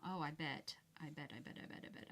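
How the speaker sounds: ordinary talk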